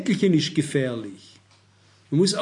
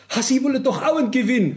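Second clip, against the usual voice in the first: high, mock-aghast caller; lottery caller shocked that he wants no prize, high mock disbelief; imitating shocked woman; 7 semitones higher than usual. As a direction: play the appalled lottery caller, pitched up. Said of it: "die war völlig entsetzt".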